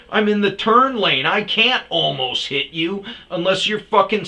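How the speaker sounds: high, loud furious rant; sustained retold shouting. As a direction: yell the whole argument at him. Heated street argument, yelling.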